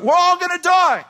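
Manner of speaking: shrieked high and loud, over and over; screaming. Acted. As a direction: yell it in full panic. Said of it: "the man in front starts screaming".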